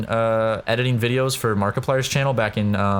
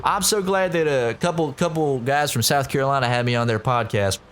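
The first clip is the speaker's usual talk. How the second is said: mock South Carolina accent; 4 semitones higher than usual. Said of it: "that fucking South Carolina accent".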